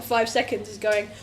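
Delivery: usual speaking voice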